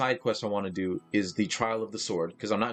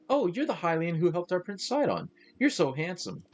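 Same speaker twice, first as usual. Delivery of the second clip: put-on girly NPC voice; 8 semitones higher than usual. Voicing Zora girl NPC dialogue.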